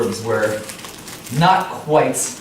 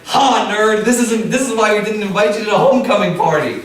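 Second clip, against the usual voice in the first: mocking bully; 8 semitones higher, faster than usual. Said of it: "Brad was like".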